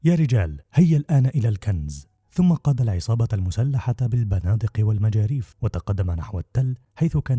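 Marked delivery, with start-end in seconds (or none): none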